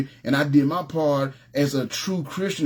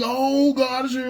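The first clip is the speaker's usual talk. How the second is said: much higher, slow, hammed-up churchy joy; mock praise; 10 semitones higher, slower than usual. Mocking people who praise only when the ark arrives, high and slow. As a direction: parody the fair-weather believers.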